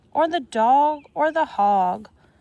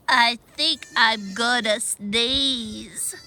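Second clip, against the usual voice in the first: garbled wheeze; slower than usual. A narrator's reading. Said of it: "his garbled wheeze".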